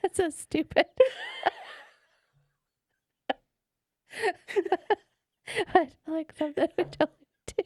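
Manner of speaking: laughing, high and loud. Real, not acted. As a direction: talk through giggles. Laughing at accent bit.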